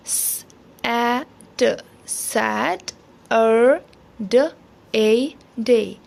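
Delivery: स, ए, ट, then सैट, अर, then ड, ए, डे, each apart; phonics sounding out. Shown as a demonstration. Teacher models Saturday for children, sound by sound. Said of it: "बच्चों को फोनिक्स के ज़रिए".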